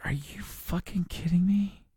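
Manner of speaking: hushed, high, slow disbelief; incredulous. Retold or imitated. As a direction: imitate exasperated disbelief under your breath. Retold reaction to being woken.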